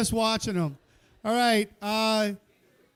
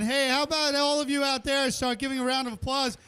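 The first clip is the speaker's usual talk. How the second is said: ordinary talk, nothing marked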